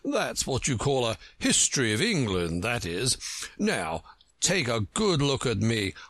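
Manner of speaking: pompous, self-important character voice; lightly voiced conceited egg, condescending; Humpty Dumpty, proud. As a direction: show off grandly to a child.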